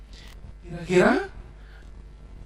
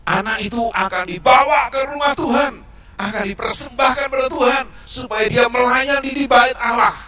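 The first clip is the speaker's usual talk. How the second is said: louder, raised storytelling emphasis; emphatic telling; 7 semitones higher, 7 dB louder than usual. A narrator's reading. Teacher stressing the mother's vow to children, loud emphasis.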